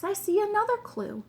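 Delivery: high, eager announcement of a find; excited discovery. A narrator's reading. Detective tells the king of a new clue, excited. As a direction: ring it out with rising excitement.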